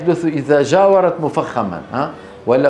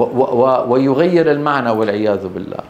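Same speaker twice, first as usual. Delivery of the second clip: normal talking voice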